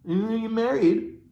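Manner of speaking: usual speaking voice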